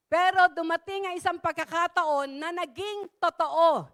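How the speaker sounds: usual speaking voice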